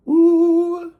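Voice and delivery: high squeal of delight at food; delighted ooh. Real, not acted. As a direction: let out a high surprised ooh. First bite of lap.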